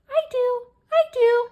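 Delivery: high, squeaky little character; pitched-way-up puppet-like voice; talking letter. Pretend. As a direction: pipe up eagerly in a tiny high voice. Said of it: "Hey, who's saying that?".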